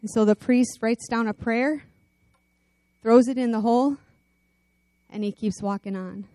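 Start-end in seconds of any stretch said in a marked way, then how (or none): none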